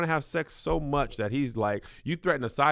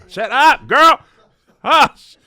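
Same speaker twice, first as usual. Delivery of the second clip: yelling at Siri; 9 semitones higher, 15 dB louder, much slower than usual. Real, not acted.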